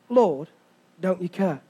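"Lord, don't you care" low and soft, joined up; quiet repeat. Shown as a demonstration. Preacher letting the disciples' words sink in, "don't you care" quiet and low. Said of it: "Their exact langu-, wa-, language is this".

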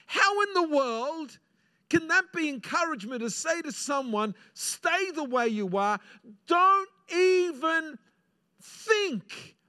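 preacher rising in passion; impassioned preaching. Acted. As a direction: stretch each word to stir the crowd. Preaching at full force.

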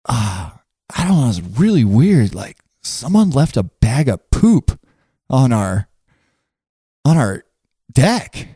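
halting imitation of a puzzled, concerned friend; mock serious. Friend gravely reporting a bag of poop to Mindy, mock serious. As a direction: break it up with long, grave pauses. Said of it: "he's got this really serious thing".

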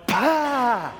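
high, loud sputtering spit-out gasp; sputtering pah. Retold or imitated. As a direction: blurt it out like burning liquor. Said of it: "like that".

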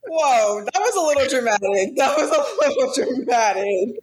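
हँसते हुए चिढ़ाना; laughing tease. Real, not acted. Reacting, laughing at friend.

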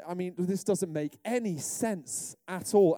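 ordinary talk, nothing marked

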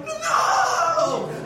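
high loud protesting shout. Retold or imitated. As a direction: yell it in denial. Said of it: "And I was like".

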